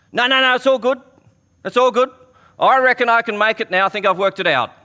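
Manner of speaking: high, loud breezy brush-off; hammed-up casual confidence; mock cocky swimmer. Mock swimmer refusing rescue.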